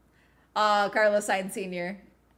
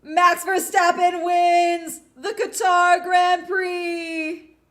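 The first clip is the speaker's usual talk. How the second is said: slow, loud, high victory shout; ecstatic shouting cry; 10 semitones higher, 9 dB louder, much slower than usual. Real, not acted. Streamer yelling as Max wins the race, ecstatic shout. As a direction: scream it out as he wins.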